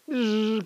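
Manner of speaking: high sustained zzz; high-pitched voiced hum through the teeth; mosquito buzz. Shown as a demonstration. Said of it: "como una, un mosquito en".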